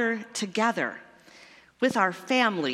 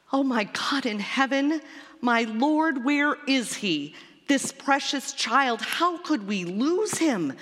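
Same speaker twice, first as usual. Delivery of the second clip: frantic mother; 6 semitones higher than usual. A narrator's reading. Mary frantic over lost child.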